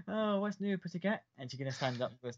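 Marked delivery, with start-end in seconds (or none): 0.1-1.2 high, tipsy and giggly. Acted. Drunk woman to the one who caught her, giggly. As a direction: play it tipsy, pitched well up. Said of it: "drunken giggles thing, and she kinda throws the hair back".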